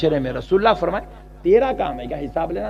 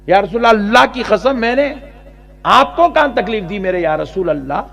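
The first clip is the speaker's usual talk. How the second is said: pleading cry; 4 semitones higher, 6 dB louder than usual. Acted. King pleads to the Prophet.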